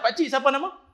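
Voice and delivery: put-on high, loud, cheeky kid voice; cheeky child. Boy in slippers boldly asking the old imam his name, high and cheeky. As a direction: pipe up like a bold little boy.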